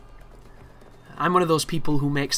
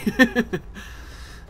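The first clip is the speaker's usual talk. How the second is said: breathy laugh; 9 semitones higher than usual. Real, not acted. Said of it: "I can't do a serious German".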